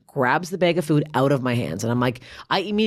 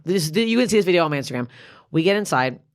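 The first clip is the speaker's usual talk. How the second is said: ordinary talk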